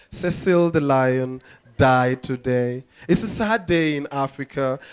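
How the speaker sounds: low, slow news announcement; solemn newsreader. Acted. Comic voicing world news on a lion, solemn newsreader. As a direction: announce a death gravely, in a low voice. Voices the BBC news report.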